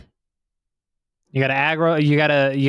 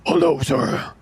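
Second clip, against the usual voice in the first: menacing wolf voice. Pretend. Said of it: "He's like".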